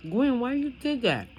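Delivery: put-on high, plaintive child's protest; childish whine. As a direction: whine it up high like a hurt kid. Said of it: "And I'd be like".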